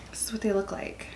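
breathy whisper, voice dropped right down. Real, not acted. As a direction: whisper it like a secret. Shows food to camera quietly.

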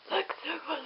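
ordinary delivery

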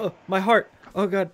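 high-pitched acted panic; freaking out. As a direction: gasp it out like a heart attack coming on. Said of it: "he kinda freaks out".

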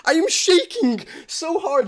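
normal talking voice

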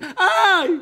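sudden very high loud cry of pain "איי"; pained yelp. Retold or imitated. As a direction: yelp out sharply as if stung. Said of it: "הוא צעק". (צעקת כאב חדה וגבוהה)

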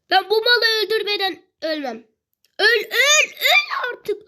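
high, loud, desperate gamer shouting; frantic frustrated yell. Real, not acted. Gamer refusing to die before killing the enemy, frantic yelling. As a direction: build to an exasperated high-pitched yell. Furiously trying to kill the enemy.

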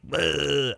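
little grot's "bleh"; imitated bleh noise. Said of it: "and he's just like".

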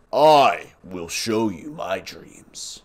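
slow, sinister low whisper-like; creepy baby voice. Pretend. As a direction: menace it slowly.

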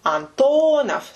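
Antonov with a long, high TO; stress on middle syllable. Shown as a demonstration. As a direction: say Antonov as an-TOO-nov, stretching the middle. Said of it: "so the stress is in the middle".

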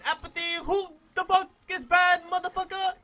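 loud, high mock questioner; imagined viewer asking. Voices viewers' question to himself.

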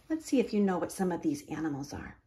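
fast soft whisper; whispered aside. A narrator's reading. Reader asking the child listener in a fast whisper. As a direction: lean in and whisper the question.

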